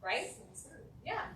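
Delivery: ordinary talk, nothing marked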